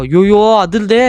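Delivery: loud, high yelp of alarm; startled, high-pitched wail; alarmed cry. Real, not acted. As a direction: cry out as things start shaking. Game shakes, player panics.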